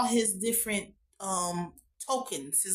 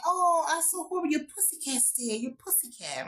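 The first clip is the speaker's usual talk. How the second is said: higher, fading sympathy act; mock sympathetic wife; 5 semitones higher than usual. Wife pretending to console Fiona about the dead cat, hammed-up sympathy. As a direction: pretend to pity her, then trail off. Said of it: "the wife kept on saying".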